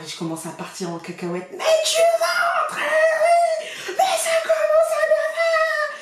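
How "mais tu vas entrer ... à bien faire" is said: cri très aigu et fort, entre rire et colère; engueule-la comme du poisson pourri, en riant; furious shout. Retold or imitated. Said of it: "je m'énerve". (hurlement aigu de mère excédée)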